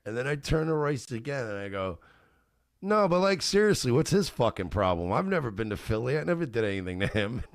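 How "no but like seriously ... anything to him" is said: loud mock indignation. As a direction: play the wronged innocent, loudly. Mock indignation to friend about stranger.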